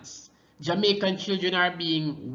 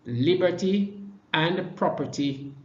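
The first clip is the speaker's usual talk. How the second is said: ordinary delivery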